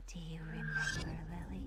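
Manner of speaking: rasping ghostly whisper; raspy ghost voice. A narrator's reading. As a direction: rasp it like a phantom. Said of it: "the voice rasps".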